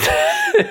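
bright quick high laugh; a short squeaky laugh; high amused giggle. Real, not acted. Laugh before praising her lines.

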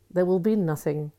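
normal talking voice

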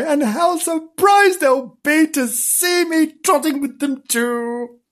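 high, slow singsong gloating; boastful kitten. A narrator's reading. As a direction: stretch it out high and proud. Kitten imagines showing off.

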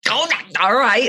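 reluctant giving in. Retold or imitated. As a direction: give in, imitating your reluctant self. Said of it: "I'm like".

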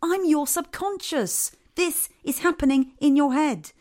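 the subconscious, fed up, spelling it out slowly for him; exasperated explaining. Acted. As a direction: drag it out like you're tired of repeating yourself. Subconscious exasperated, slow, high.

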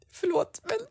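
high, tearful apology; half-sobbed sorry, voice cracking upward; retold breakdown. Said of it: "Jag bara bröt ihop". (återberättad gråtande ursäkt, hög röst)

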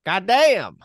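loud high exasperated damn; frustrated curse. Pretend. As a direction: curse at the missed chance. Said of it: "He's like".

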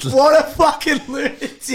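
high, slow incredulous cry; amused disbelief. Real, not acted. Host repeating his verdict on [name], amazed outcry. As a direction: shout it high, stretching the words.

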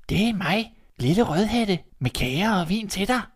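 high, sweet, put-on girlish voice; a wolf playing innocent little girl; fake little girl voice. Wolf to grandmother, faking Red's high girl voice.

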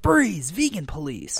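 high, slow mock police command; vegan police. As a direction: stretch out "freeze" like a cartoon cop. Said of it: "the vegan police come in".

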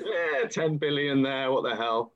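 drawn-out, careless mock shrug; slow, mock-casual dismissal; mock nonchalance. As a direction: toss it off slowly, imitating breezy big spenders. Acted quote of careless spenders.

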